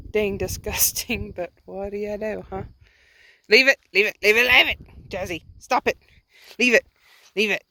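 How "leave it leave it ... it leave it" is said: sharp, loud and insistent, over and over; loud repeated command. Real, not acted. Owner calling her dog off cow piles, loud and insistent. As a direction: call the dog off, loud and firm.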